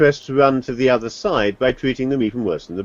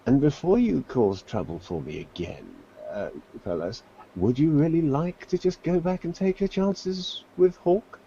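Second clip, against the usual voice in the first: low conspiratorial aside; 5 semitones higher, 6 dB quieter than usual. Acted. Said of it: "and much quieter".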